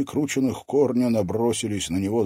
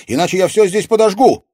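put-on fast, high, loud girl's threat; defiant high yell; shouted threat; 12 semitones higher, 11 dB louder, faster than usual. A narrator's reading. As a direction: fling the threat out in a rush. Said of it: "крикнула Катерина".

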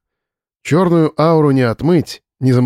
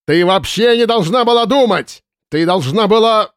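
angry shouting; 8 semitones higher than usual. A narrator's reading. Boss keeps shouting, then breaks off.

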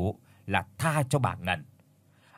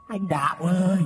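higher ghostly call; 5 semitones higher than usual. Acted. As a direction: call him again, more insistent. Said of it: "có tiếng thì thào".

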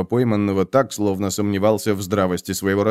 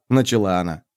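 ordinary delivery, nothing marked

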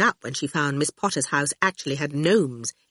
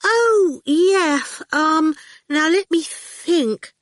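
high, flustered stalling, slowing down; distracted; 11 semitones higher, 4 dB louder, much slower than usual. A narrator's reading. Miss Potter asked for the typescript, distracted. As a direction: dither in a high voice, slowing to think. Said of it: "put her hand to her head distractedly".

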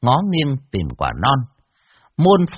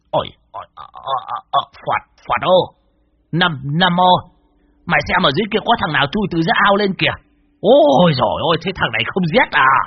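frightened alarm; 6 semitones higher, 4 dB louder than usual. A narrator's reading. Old man warns his companions of a ghostly boy, frightened alarm. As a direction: stammer first, then rise high into alarm.

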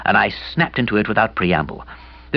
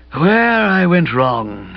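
slow, drawn-out and hollow, raised in pitch; husky ghostly voice; 8 semitones higher, 3 dB louder, much slower than usual. A narrator's reading. Kipper explains his mistake to Bertie and aunt, husky ghostly voice. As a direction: stretch it out like a suffering ghost. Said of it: "still speaking in that low, husky voice".

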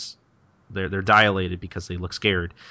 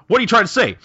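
defensive outburst; 11 semitones higher, 7 dB louder, faster than usual. Acted. Gintoki defensive about ghosts.

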